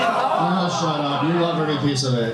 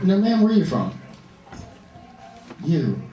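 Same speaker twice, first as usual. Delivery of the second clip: usual speaking voice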